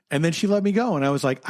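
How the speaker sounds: ordinary talk, nothing marked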